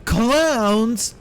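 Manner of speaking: gasp, then a high, drawn-out mock cry; hammed-up alarm. Streamer spotting puppet clowns in the game, hammed-up alarm.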